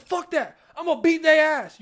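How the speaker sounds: high, loud brash threat; mock furious villain. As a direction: fire up for a fight. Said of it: "Ravana's like".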